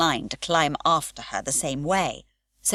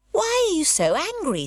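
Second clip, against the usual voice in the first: reproachfully; 11 semitones higher than usual. Acted. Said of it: "said Pippi reproachfully".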